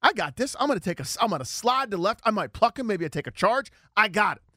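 keyed-up, sure-of-yourself inner voice; retold confident self-talk. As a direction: act out cocky in-the-moment thinking.

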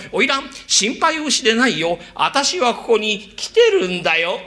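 put-on dashing young master. Zen playing the dashing young master arriving for the courtesan. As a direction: sweep in and calm her gallantly. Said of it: "あなたが颯爽と登場する".

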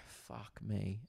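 low, soft defeated groan; quiet despairing aside; muttered dismay. Retold or imitated. Comedian quietly dreading two weeks of jokes. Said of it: "I go".

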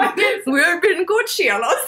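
loud, high hammed-up Scottish shout; Scottish accent. Showing her co-host a Scottish accent, shouted and hammed-up. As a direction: bellow it like a Scottish sailor. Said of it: "Scottish is like this".

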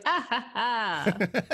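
slow, hammed-up laugh; a character laughing into a mug, slow and hammy; mock laugh into a mug. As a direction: fake a drawn-out laugh. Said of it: "picks up a mug and is like".

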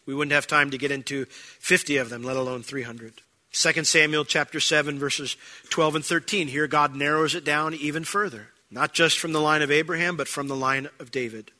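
ordinary delivery

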